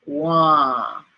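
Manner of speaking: "wah" held long and open; long nasal wah. Shown as a demonstration. Teacher breaking down the nasal sound. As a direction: stretch the A in "wah". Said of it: "that's the long A".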